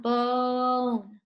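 "bone" stretched on a long, level o; slow sounding-out. Shown as a demonstration. Teacher models a word to hear that sound, slow "bone". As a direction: say "bone" slowly so the vowel is heard. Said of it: "Make sure you say it slow".

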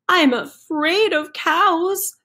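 a nervous chicken balking at the jump; scared chicken. Acted.

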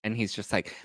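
usual speaking voice

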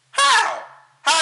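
high, loud disbelieving "How?"; sudden squeak of disbelief; incredulous how. Real, not acted. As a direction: shoot up in outraged disbelief. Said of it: "outraged question".

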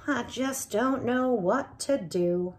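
put-on lively read-aloud for children; animated storyteller voicing each character; story narration. A narrator's reading. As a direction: tell it like a bedtime storybook. Reading picture book aloud.

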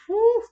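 a very high, loud whew of release; loud squeaky exhale of relief; emotional whew. Real, not acted. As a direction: blow out a high whew to hold back tears. Host fighting back tears.

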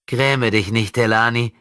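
mock his grief; scornful. Possessed boy scorning André's grief, sneering. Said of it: "sagte Frederik höhnisch".

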